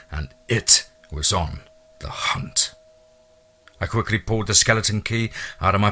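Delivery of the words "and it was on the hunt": slow, breathy ominous hush; ominous whisper. A narrator's reading. Narrator sensing a hunting predator, ominous slow whisper. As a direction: whisper it like a threat in the dark.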